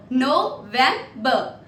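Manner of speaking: NOVEMBER split as No, vem, ber; syllable-by-syllable demo. Shown as a demonstration. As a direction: break "November" into three separate syllables. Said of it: "I'll pronounce it for you".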